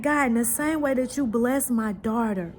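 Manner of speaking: fervent prayer. Retold or imitated. Mother retelling her own prayer to God, fervent rising plea ending in a whisper. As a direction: pray earnestly, building, then hush.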